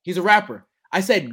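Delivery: normal talking voice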